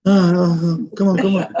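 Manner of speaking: low, loud groan of impatience; mock weary groaning. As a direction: play someone dragging themselves in, worn out. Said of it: "come to Shabbat like this".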